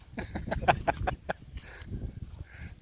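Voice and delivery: an unvoiced burst of laughter; breathy laugh. Real, not acted. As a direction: laugh it off, amused at yourself. Laughing about building forts.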